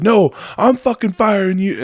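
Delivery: high, heated retort; retold shouted firing; angry boss. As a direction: act out a boss yelling over an employee. Acted boss shouting back.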